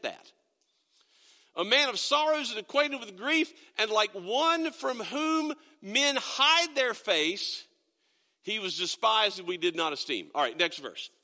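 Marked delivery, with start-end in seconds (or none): 1.5-10.3 slow, measured Bible reading with weight on each phrase; scripture reading. Acted. Pastor reading Isaiah to the church, slow and solemn. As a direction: read the verse slowly to the congregation.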